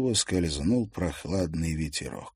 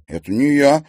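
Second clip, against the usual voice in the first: tense, raised-pitch denial; tense reply; 7 semitones higher, 8 dB louder than usual. A narrator's reading. Timofey denies knocking over the bucket, tense reply. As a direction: answer tensely, louder and higher. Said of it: "напряжённо ответил Тимофей".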